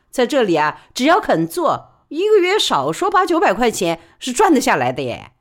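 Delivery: brisk high-pitched working woman pleading her case; Li Baoli's voice. Acted. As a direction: press her case fast and eager. Li Baoli argues.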